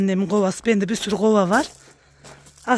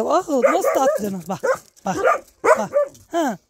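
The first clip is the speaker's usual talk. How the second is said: much higher, sing-song pet-talk to the geese; talking to animals; 9 semitones higher than usual. Real, not acted. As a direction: coo and tease the birds like babies. Cooing at geese for many lines. (kazlarla ince, sevecen sesle konuşma)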